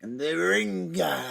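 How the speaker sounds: slow, stretched ending on RINGER; hammed-up wrestling promo; mock wrestler voice. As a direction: drag out Ringer like a wrestler's catchphrase.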